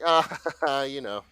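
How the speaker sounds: light laughing dismissal; half-laughing you know; making light of it. Retold or imitated. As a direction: brush it off with a little laugh. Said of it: "sort of making light of it".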